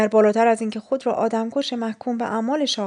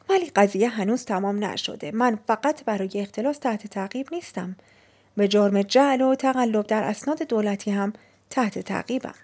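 ordinary delivery

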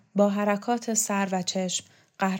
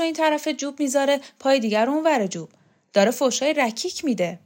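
higher, louder haughty protest, rushing; pompous indignation; 5 semitones higher, 5 dB louder, faster than usual. Acted. Borzu protests being compared to a thug, higher and indignant. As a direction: puff up with offended pride. Borzu angrily defends his dignity.

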